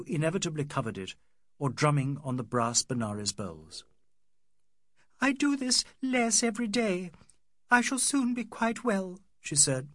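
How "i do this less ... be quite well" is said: put-on raised voice of a young woman after crying; higher young woman's voice, lightly tearful. A narrator's reading. Adela to Ronnie, brushing off her crying, higher voice. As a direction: lift the voice for Adela, just after tears. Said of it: "blowing her nose and feeling hideous".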